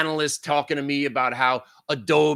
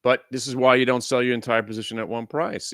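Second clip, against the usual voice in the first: ordinary delivery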